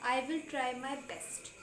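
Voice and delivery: very slow, each word laid out for learners; slow teaching read. Shown as a demonstration. Teacher reading an English phrase to students, very slow. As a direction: spell it out slowly so students can repeat. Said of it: "मैं पूरी कोशिश करूँगा".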